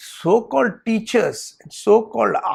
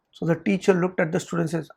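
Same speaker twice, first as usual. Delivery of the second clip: usual speaking voice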